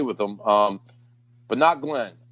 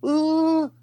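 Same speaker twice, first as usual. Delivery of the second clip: hammed-up "Ooh" much higher; mock dazed moan; 12 semitones higher than usual. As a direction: play a spaced-out woman cooing "Ooh". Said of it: "she's like".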